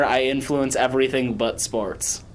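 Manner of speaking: ordinary talk, nothing marked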